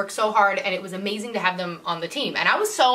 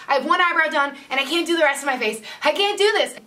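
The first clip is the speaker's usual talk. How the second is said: higher, rising mock panic; freaking out; 6 semitones higher than usual. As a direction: panic about the half-done face. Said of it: "I was like".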